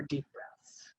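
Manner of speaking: soft breathy whisper of the words; hushed deep breaths. Shown as a demonstration. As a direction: whisper it like calming a child. Showing calm breathing with child.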